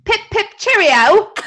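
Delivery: high, loud mock British cheerio; British sign-off. Signing off the podcast in a hammed-up British accent. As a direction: ham up a posh British goodbye. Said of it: "a sign off that was requested".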